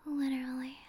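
usual speaking voice

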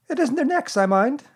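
high, earnest little creature voice. A narrator's reading. Piglet explaining his fears to Pooh, earnest. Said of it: "said Piglet earnestly".

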